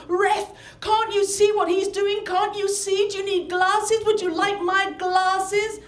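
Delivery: high, indignant heckling of the referee; yelling aunt. Retold or imitated. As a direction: harangue the ref like an outraged ringside fan. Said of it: "she turned into a yelling, screaming hoyden".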